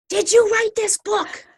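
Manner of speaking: high, loud, slow excited question from a stranger; a big animated woman asking at the car window. Retold or imitated. Stranger at the car window asks, high and loud.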